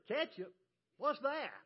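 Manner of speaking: high, loud, incredulous comeback; mock bafflement. Customer throwing the counter girl's question back, mock incredulous.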